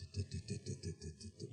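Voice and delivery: quiet, rapid stuck syllables; imitated nervous stutter. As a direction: imitate freezing up, stammering softly. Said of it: "이랬어요".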